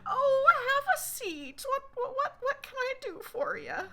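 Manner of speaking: put-on an old mom greeting a guest from her rocking chair; mom's welcoming voice. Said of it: "And she says".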